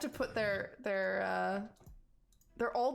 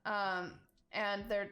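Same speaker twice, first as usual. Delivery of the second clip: normal talking voice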